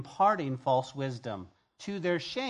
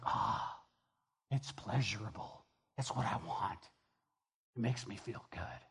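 mock indulgence; 6 semitones lower, 8 dB quieter than usual. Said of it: "Sensual is like".

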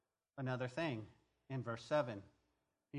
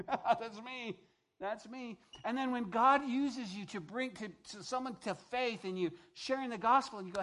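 normal talking voice

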